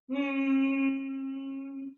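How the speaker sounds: long level hum on one unwavering tone; even steady hum. Shown as a demonstration. Teacher demonstrating the bee breath hum to her class, a steady even hum. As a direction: hold one flat, steady humming note. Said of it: "When you hum, try to keep an even tone".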